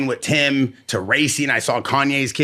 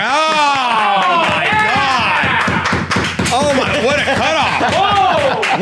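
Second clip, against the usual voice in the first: excited outcry; 11 semitones higher, 3 dB louder, much slower than usual. Real, not acted. Host cut off mid-introduction.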